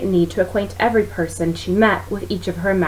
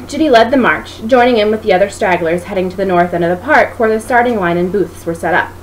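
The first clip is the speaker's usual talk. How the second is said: ordinary delivery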